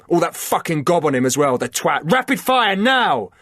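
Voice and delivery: rising yell ending in an order; shouted order. A narrator's reading. Liam ordering his team to fire, shout building. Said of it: "shouted Liam".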